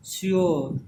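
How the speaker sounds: श्योर stretched, "syo-o", falling; emphatic teaching model of श्योर; sounded-out vowels. Shown as a demonstration. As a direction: draw out the vowel glide in श्योर. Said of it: "और ओ का sound आ रहा है".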